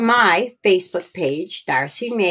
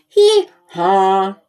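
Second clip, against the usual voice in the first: mock hee-haw, louder; donkey bray; 5 dB louder than usual. Narrator imitates the donkey sound.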